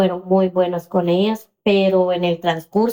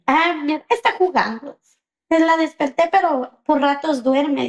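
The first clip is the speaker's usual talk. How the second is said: lightly voiced child, higher pitched; crying daughter; 6 semitones higher than usual. Daughter telling mother about her sister, plaintive. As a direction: imitate a child explaining in a small upset way. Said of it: "porque su hija está llorando".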